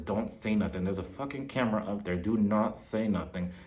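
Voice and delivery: low, hushed, urgent warning; secretive warning. Retold or imitated. Partner warns Louis about the camera, secretive and hushed. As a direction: keep it low and under the radar.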